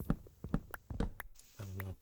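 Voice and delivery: usual speaking voice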